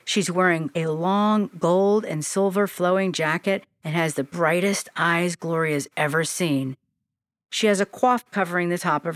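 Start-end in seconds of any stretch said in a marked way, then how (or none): none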